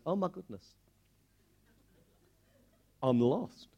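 a sheep looking up from its grass, quietly dismayed; startled sheep. Acted. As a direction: gasp it out as a sheep realizing it's lost. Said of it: "he looks up and goes".

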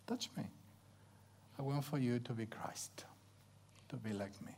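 soft, near-whispered words from God; hushed message. Acted. God giving the preacher his calling, hushed and tender. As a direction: let each phrase sink gently into a hush. Acting God's message to him.

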